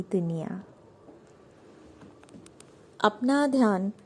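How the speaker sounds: measured, soothing meditation guide; unhurried yoga nidra instruction; guided meditation. Acted. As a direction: lead them into relaxation with long pauses between phrases.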